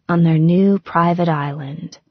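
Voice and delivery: usual speaking voice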